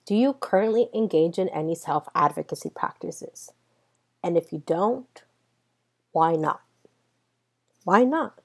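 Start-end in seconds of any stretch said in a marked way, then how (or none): none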